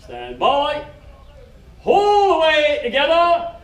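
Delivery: loud, high staged commands with pauses; petty officer drilling recruits, loud and slow; called-out hauling orders. Retold or imitated. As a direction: give each order its own beat, full voice. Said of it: "petty officer giving hauling orders".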